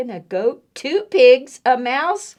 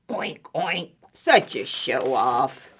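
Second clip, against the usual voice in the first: low piggy disapproval; oink, then drop low in scorn; grumbling pigs; 5 semitones lower than usual. Acted. Reader repeats pigs' thought, low piggy grumble.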